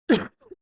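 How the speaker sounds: sharp loud cough; dust coughing. Real, not acted. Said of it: "dust in my throat".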